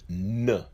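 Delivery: "N" as "nuh"; letter sound N. Shown as a demonstration. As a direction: sound out "N" with a short schwa. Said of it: "The Nun is pronounced as".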